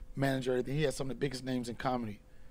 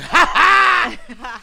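loud, high exaggerated "ha ha"; mock big laugh; 9 semitones higher, 16 dB louder than usual. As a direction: imitate an over-the-top open laugh. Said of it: "like, [name] laughing. He wasn't like".

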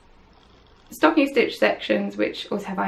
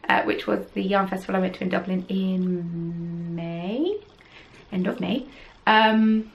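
ordinary talk, nothing marked

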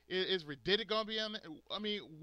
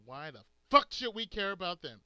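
loud indignant outburst; 7 dB louder than usual. Real, not acted. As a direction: burst out loud in real outrage. Indignant rhetorical question in rant.

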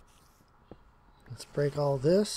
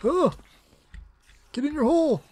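high, shrill-free yell at enemies; frustrated yell; 11 semitones higher than usual. Real, not acted. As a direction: shout them back down. Yelling at mobs.

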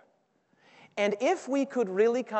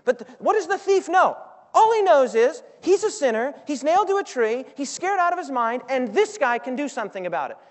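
rising preacher; 5 semitones higher, 5 dB louder than usual. Real, not acted. Preaching climax, rising intensity.

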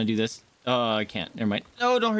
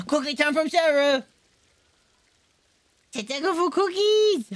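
much higher gasping squeal; high excited cookie voice; 12 semitones higher, 4 dB louder than usual. Pretend. Gamer squealing with delight over cookies from Sarah. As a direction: gasp with childish delight.

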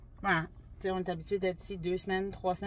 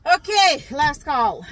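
loud, very slow drawn-out call; mock last call; 5 semitones higher, 12 dB louder, much slower than usual. Vloggers joking at the end of a blooper take, slow mock barman's call. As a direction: bellow it like a barman at closing.

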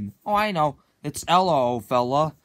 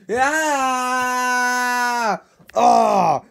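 high, loud frightened scream; scream of fright; 6 semitones higher, 5 dB louder than usual. Acted. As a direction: scream as the monster appears. Woken by nightmare monster.

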